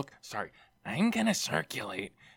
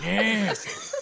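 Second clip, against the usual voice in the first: crab voice; 11 semitones higher, 4 dB louder than usual. Acted. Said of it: "Gnarly little Peter Lorre".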